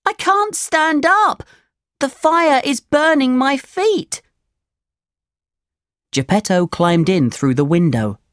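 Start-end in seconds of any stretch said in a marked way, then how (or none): 0.0-4.2 very high, panicky puppet wail; high-pitched alarm from a little puppet; distressed puppet. A narrator's reading. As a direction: cry for help in a high childlike voice. Puppet with burning feet calls out.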